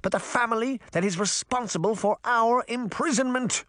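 high, raging imperious voice; raging queen. Acted. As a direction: stir the crowd to fury. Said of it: "the Queen raged".

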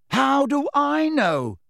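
high, drawn-out angry bellow. A narrator's reading. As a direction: bellow it slowly in exasperation. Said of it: "bellowed Harry".

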